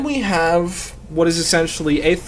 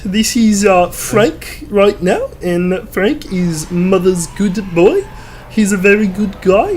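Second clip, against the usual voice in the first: put-on high, slow, prim good-boy voice; a mama's boy being polite; polite Frank voice; 6 semitones higher, slower than usual. Shown as a demonstration. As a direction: play the mother's good boy, sweet and proper. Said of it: "I voiced him like this".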